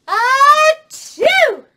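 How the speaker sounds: loud, high mock sneeze; a huge theatrical sneeze; big sneeze. Ant marching into pepper, loud hammed-up sneeze.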